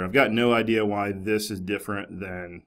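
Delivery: usual speaking voice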